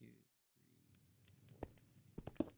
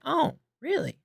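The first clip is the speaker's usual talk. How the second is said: much higher sarcastic "really"; mock incredulity; 4 dB louder than usual. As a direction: pretend to be shocked, sarcastically. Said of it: "I was like".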